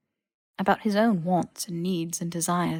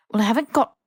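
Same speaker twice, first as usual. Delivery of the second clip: sharp, snippy start of a retort; 4 semitones higher, 6 dB louder, faster than usual. Acted. Malfoy snapping at Harry, snippy. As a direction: bite the words off, peeved. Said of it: "Malfoy started snippily".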